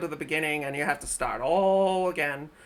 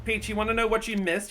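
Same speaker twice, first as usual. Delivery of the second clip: ordinary talk, nothing marked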